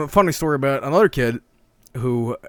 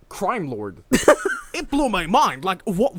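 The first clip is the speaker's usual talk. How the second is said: plain reading voice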